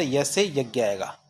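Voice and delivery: normal talking voice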